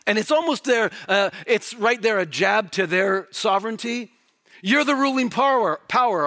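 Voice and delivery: loud, high, impassioned preaching; full-force preaching. Acted. Preacher thundering at the congregation about the court, loud and high. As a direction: drive it home to the congregation, full voice.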